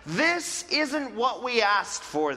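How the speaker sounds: hammed-up ungrateful grumble; grumbling declaration. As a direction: whine about the old days. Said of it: "they declared".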